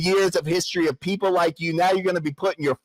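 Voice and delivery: fast, rushed menace; furious fast threat; angry threat. Real, not acted. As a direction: rattle off the threat. Escalating threats in the argument.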